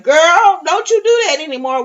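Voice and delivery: high, loud, quick mock scold of a grandchild; playful imagined telling-off. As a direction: wag a finger at her.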